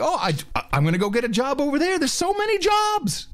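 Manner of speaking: high, rushed gushing climbing to a squeal; mock excited lady. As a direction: mimic a gullible lady, pitch soaring. Acts some lady's excitement.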